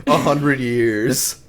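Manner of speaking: very slow, stretched words, rising at the end; drawn-out mocking. Co-host mocking the vampire's age, drawn-out and teasing. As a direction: stretch it out for comic effect.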